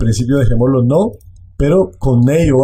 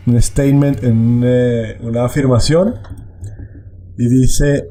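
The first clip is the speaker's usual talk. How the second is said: normal talking voice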